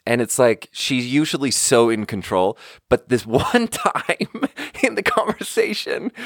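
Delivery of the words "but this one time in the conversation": laughing. Real, not acted. Laughing while telling the story.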